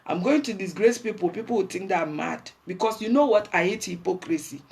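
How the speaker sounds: ordinary delivery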